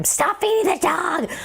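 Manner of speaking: high-pitched mock yell, no real volume; imitated mom yelling. Mom to dad about overfeeding the dog, mock yelling. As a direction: imitate an exasperated mom's scolding. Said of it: "my mom keeps yelling at him".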